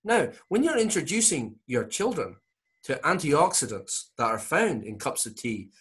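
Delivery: put-on lilting Scottish accent, pitched high at first; Norman Swan. Comedian as radio doctor advising parents on tea, Scottish accent. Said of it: "This is Norman Swan advising Australian parents".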